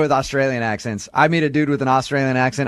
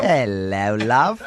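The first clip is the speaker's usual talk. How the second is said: put-on British accent; 5 semitones lower, much slower than usual. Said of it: "He just kept getting closer, going".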